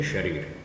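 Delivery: शरीर with "sh" at the start; correct pronunciation. Shown as a demonstration. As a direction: say शरीर as "sharir". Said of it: "ठीक शब्द बोलेंगे".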